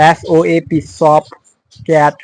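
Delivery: ordinary delivery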